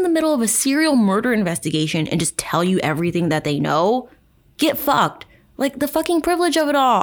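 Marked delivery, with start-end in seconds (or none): 4.6-7.0 high-pitched, indignant dismissal; fed-up ranting in a raised pitch; exasperated. Real, not acted. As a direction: scoff at her sense of entitlement. Exasperated rant at the book's author.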